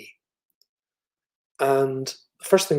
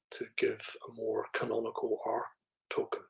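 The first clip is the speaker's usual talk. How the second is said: whispered; 9 dB quieter than usual. Real, not acted. Quiet aside on canonical R.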